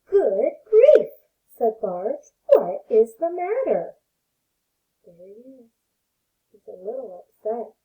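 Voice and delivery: gentle read-aloud voice for little ones; storybook reading. Acted. Teacher reading a picture book to her pre-K class, gentle storytelling.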